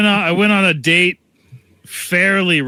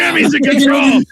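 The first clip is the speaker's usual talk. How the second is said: shouting; 13 semitones higher, 5 dB louder than usual. Pretend. Mock takeover announcement.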